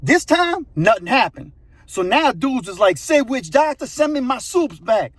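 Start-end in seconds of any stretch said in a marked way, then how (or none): 3.0-5.1 mock angry demand. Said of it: "And them dudes are mad".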